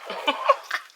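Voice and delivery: airy breathy chuckle; breathy giggle; breathy laugh. Real, not acted. Laughing at own mix-up.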